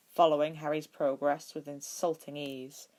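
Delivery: usual speaking voice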